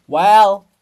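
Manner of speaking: loud, impatient call back; a loud, gruff answer; loud reply. Acted. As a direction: bark back from far off. Monument answers the summons.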